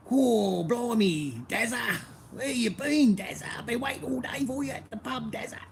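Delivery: high broad Australian accent calling Dazza; Bogan impression. Shown as a demonstration. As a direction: ham up an Aussie mate at the pub. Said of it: "Yeah, bit like that".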